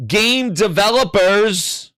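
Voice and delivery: "Game developers" drawn out slowly, each word weighted; slow and clear. Shown as a demonstration. Streamer stressing the term again for slow viewers. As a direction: lean on "Game developers" slowly like explaining to a child. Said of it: "for those that are hard of hearing".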